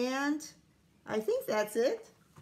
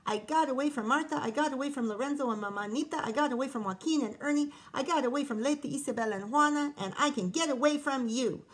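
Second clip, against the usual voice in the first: fast, breathless boasting; out of breath; 4 semitones higher, faster than usual. Acted. Cookie boasting breathlessly to a girl, fast and panting. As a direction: rattle it off, panting a little. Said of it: "said Piggy out of breath".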